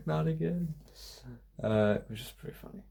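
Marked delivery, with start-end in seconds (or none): 0.1-0.7 mild mock yell of a heckler, slowing at the end; a half-hearted shout from the crowd, begging it to stop; retold crowd yell. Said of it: "a woman in the crowd yelling".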